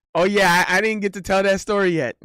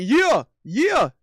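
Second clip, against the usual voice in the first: higher, swooping "yeah"; mock egging on; 5 semitones higher, 4 dB louder than usual. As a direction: cheer your girlfriend on from the side. Acts out backing his girlfriend.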